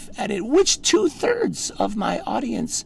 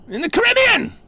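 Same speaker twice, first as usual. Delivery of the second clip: climbing higher and louder to a shout on every island; mock-grand escalating proclamation; building rant; 7 semitones higher, 6 dB louder than usual. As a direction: pile up the places till you're yelling.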